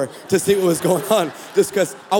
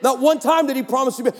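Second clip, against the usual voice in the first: ordinary talk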